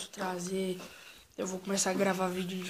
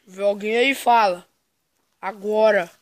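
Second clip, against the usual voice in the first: loud challenge into the dark; shouting; 12 dB louder than usual. Real, not acted. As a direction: call out loud at the shadow. Shouting at unseen figure.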